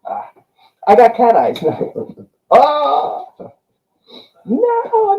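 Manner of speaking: ordinary talk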